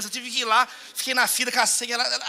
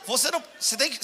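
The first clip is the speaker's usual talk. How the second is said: ordinary talk, nothing marked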